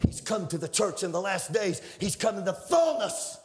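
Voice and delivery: high, rising sermon delivery, shouting the peaks; fiery preaching. Acted. Preacher to the congregation about the vine, fiery.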